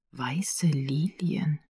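soft, drawn-out whisper; hushed, slow narrated whisper. A narrator's reading. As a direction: whisper it very slowly, lingering on each word. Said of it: "flüsterte sie".

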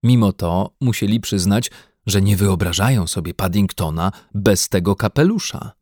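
plain reading voice

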